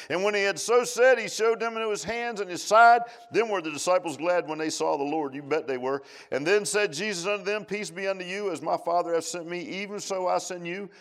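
high, loud declaiming of scripture; preached scripture reading. Acted. Preacher reads John twenty aloud to congregation, loud high declaiming. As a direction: proclaim the text to the church. Continues scripture at full force.

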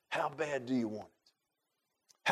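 slow, soft, searching question; hushed challenge. Real, not acted. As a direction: drop soft and stretch the question out. Preacher challenging his listeners.

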